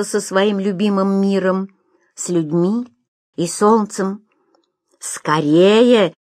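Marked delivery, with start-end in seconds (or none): 5.0-6.1 impatient exclamation. A narrator's reading. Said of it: "нетерпеливо воскликнул эмир".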